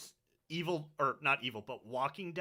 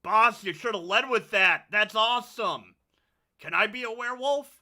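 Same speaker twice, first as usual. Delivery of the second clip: loud, high, eager shout; excited yell; 6 semitones higher, 7 dB louder than usual. Acted. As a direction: call up eagerly to the boss. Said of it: "yell out".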